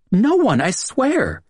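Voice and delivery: high, pleading female voice; pleading woman. A narrator's reading. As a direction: beg him to believe you, pitched high. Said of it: "Julia pleaded".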